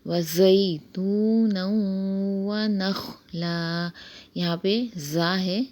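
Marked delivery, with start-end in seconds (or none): 0.1-3.9 slow, drawn-out tajweed recitation; slow Quran recitation. Shown as a demonstration. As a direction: recite the ayah slowly with stretched vowels. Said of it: "پوری آیت کو اس طرح سے پڑھا جائے گا".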